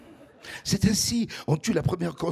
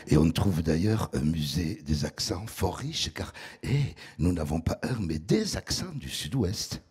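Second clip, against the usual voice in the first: deep velvety southern voice; 7 semitones lower than usual. Pretend. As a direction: put on a deep velvet voice, like a charmer. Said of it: "il faut prendre un ton chaleureux, grave, hein, un velouté". (voix grave et veloutée, ton chaleureux)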